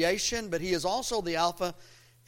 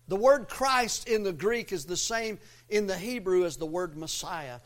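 ordinary talk, nothing marked

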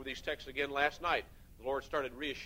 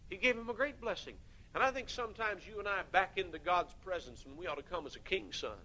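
ordinary talk

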